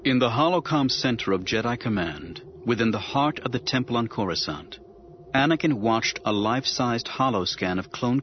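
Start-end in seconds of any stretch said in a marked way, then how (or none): none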